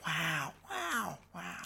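Owen Wilson wow. Retold or imitated. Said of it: "the theme music".

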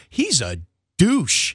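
slow, drawn-out put-down; slow deadpan. Real, not acted. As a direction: drag it out dryly. Dry verdict on contestant.

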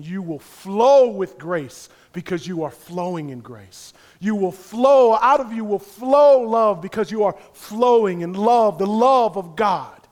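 loud, high pulpit preaching; fiery sermon climax; preaching at full force. Acted. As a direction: drive it home to the congregation.

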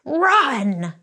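sharp high bossy order, imitating the customer; demanding customer. Entitled customer orders the cashier, bossy mock bark. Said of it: "she goes".

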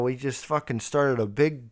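usual speaking voice

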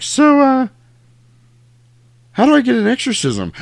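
high, loud put-on voice; awkward mock question. Mother awkwardly asking the priest, high-pitched mock voice.

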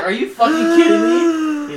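high, drawn-out yell of disbelief; hammed-up, loud, stretched-out disbelief; incredulous shout. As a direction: cry it out slowly in outraged amazement. Retold disbelief at the hidden card.

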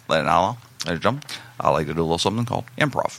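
low, quick mock stage patter; Robbie's talking voice. Bombing comic addressing a biker bar, low imitated stage voice. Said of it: "he talked like this".

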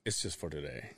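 very low, hushed aside; soft, secretive murmur; retold whisper. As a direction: say it under your breath, imitating a whisper. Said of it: "I whisper".

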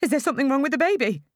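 high, fast, loud panic; mother panicking about her baby, fast and high; panicked. A narrator's reading. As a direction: rush the question out in fear. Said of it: "I panic".

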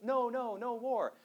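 high mock protest shout, held back. Lecturer imitating shouting peace activists, high mild mock shout. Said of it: "activists who are shouting".

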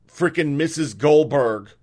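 ordinary talk, nothing marked

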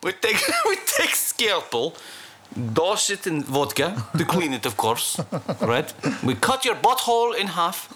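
laughing mock Russian accent; hammed-up Russian surgeon.

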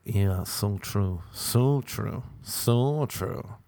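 slow, softly fading mock agreement; agreeing friend. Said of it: "our friend was like".

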